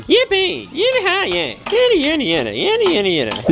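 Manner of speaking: high-pitched rodeo whoops; playful yee-haw riding cries, starting very high; cowboy whooping. Pretend. Toy Florida man riding the dinosaur, cowboy whooping.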